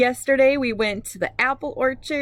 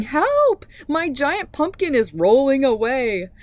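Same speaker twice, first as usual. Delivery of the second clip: high, loud cry for help; frantic yell; 4 semitones higher, 4 dB louder than usual. Acted. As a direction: shout it as a girl chasing her runaway pumpkin.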